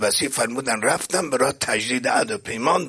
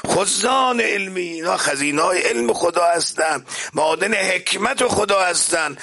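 high, ringing sermon delivery; impassioned preaching; 6 semitones higher than usual. Acted. Preacher proclaims the Prophet's named successors, impassioned and high-pitched. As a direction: build in pitch through a solemn list.

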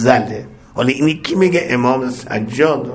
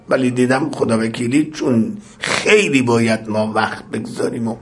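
usual speaking voice

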